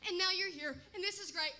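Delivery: ordinary delivery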